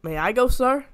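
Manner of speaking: eager. A narrator's reading. Donald eagerly asking the boss to send him. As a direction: ask keenly to be chosen. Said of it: "said Donald eagerly".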